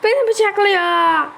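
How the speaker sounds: high loud cry of being stabbed; panicked yell. Real, not acted. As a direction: yell while getting stabbed. Bıçaklanırken bağırıyor. (panikle bağırarak)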